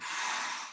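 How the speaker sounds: slow audible out-breath; calm breathing out; exhale. Shown as a demonstration. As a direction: blow the air out softly. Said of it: "I blow out".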